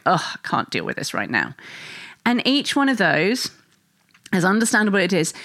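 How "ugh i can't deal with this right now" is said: low, weary grumble of a tired parent; mild exasperated groan, pitched low; fed-up sigh. Retold or imitated. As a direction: mutter it low like you've had enough. You're going.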